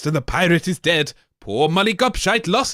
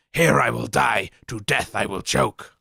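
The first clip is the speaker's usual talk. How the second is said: lower, fading dying gasp; dying monster; 8 semitones lower than usual. Acted. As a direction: choke out the last words. Monster dies.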